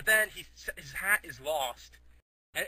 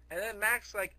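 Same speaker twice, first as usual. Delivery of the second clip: ordinary delivery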